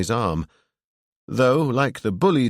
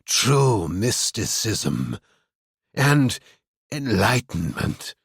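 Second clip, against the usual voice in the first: slow, savoring grand words; excited; much slower than usual. A narrator's reading. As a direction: relish each word slowly. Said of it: "a burst of excitement".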